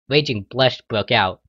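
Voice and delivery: ordinary delivery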